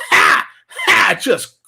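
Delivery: harsh breathy mock coughs, loud; mimicking a sick lady hacking away; imitated hacking cough. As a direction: bark out her nasty cough. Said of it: "I can hear her ha".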